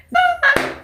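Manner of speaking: quick high giggle; short laugh. Real, not acted. Amused at calling the pastor daddy, short giggle. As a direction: let out a small high laugh.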